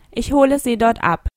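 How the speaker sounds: ordinary talk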